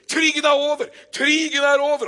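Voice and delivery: high, loud, jubilant yell; excited shouting. Acted. Newsboy shouting the war is over to the crowd. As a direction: shout the good news with joy.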